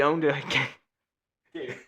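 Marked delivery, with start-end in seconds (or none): none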